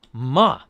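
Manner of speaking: loud, falling MA; fourth tone. Shown as a demonstration. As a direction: drop MA hard from the top. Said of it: "Okay, fourth tone".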